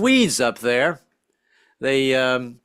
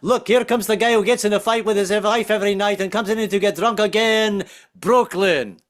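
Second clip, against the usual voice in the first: high, run-on announcement of a long name; Greek restaurant man; 6 semitones higher, 3 dB louder than usual. Acted. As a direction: rattle it off as one name, then land on the town. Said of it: "back up and say it again".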